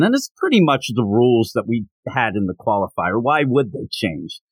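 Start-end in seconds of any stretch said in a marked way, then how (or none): none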